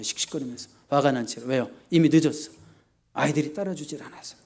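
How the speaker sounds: ordinary delivery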